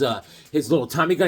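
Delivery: normal talking voice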